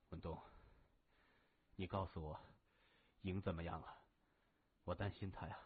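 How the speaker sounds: low, soft invalid's voice; weak, worried plea. A narrator's reading. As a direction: ask quietly, afraid for the one you love. Injured man anxiously asks after beloved. (虚弱而担忧地低声问)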